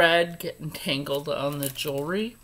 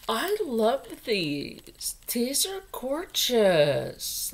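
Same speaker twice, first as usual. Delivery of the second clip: swooning; 4 semitones higher, much slower than usual. Real, not acted. Swooning over pearl earrings.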